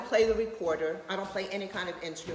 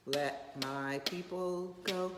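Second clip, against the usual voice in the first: imitating granddaughter; 5 dB quieter, much slower than usual. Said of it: "Whenever she sees a cane".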